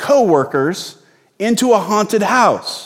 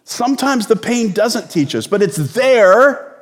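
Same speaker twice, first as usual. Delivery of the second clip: ordinary talk, nothing marked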